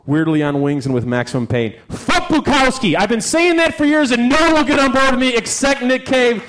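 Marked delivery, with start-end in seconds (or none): 1.9-6.4 emphatic rant. Real, not acted. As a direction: proclaim it high to the crowd. Worked-up rant about Bukowski on stage.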